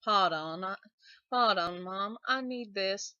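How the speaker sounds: cliche southern accent with dropped R. Shown as a demonstration. Giving viewers examples of cliche southern phrases, southern accent. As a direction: put on a stock southern accent. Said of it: "something that is cliche southern".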